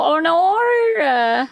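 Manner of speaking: dismayed oh no. Real, not acted. Reacting to the failed repair, a high dismayed cry. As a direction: yelp as the bad news lands.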